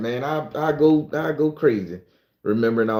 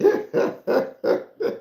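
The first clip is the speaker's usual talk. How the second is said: breathy giggle. Real, not acted. Laughs at chat's reaction.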